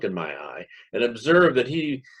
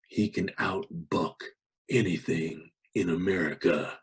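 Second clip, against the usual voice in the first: breathed into the ear; 4 semitones lower, 6 dB quieter than usual. Acted. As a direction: lean in and breathe it close. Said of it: "breathed in my ear impressively".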